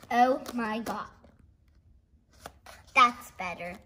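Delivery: normal talking voice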